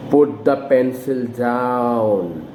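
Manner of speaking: slow "Put the pencil down", sliding low to DOWN; falling command intonation. Shown as a demonstration. Teacher commands a small kid, slow falling intonation. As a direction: draw it out, pitch falling steadily onto "down". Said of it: "we use falling intonation".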